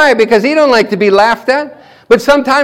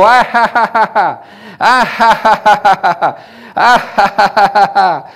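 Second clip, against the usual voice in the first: imitated laugh. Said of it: "And he would go".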